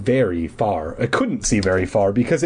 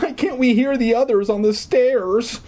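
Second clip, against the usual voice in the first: nervous demand; 13 semitones higher than usual. A narrator's reading. Eddie, lost on the stairs, demanding high and nervous. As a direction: stretch it out anxiously.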